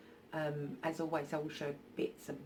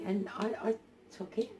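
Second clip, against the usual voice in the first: usual speaking voice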